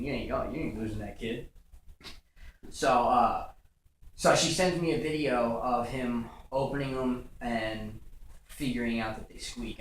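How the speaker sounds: usual speaking voice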